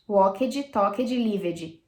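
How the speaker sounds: walked, talked and lived with a spoken ed; wrong pronunciation. Shown as a demonstration. Teacher imitating common student errors. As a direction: exaggerate the wrong ed-ji endings. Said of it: "de jeito nenhum você vai pronunciar ed".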